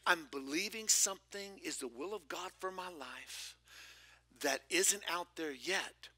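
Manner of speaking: usual speaking voice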